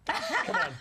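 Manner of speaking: high, laughing mock squeal; teasing squeal. Real, not acted. Host teases the actress about the mustache, squealing. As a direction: push her playfully with a high-pitched come on.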